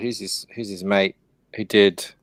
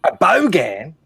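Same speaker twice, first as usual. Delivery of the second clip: Australian "bogan"; slower than usual. Pretend. Friend shows the American how Aussies say it, mock Australian accent. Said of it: "is that how you say it".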